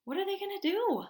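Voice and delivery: worried. Pretend. Worried question to kids.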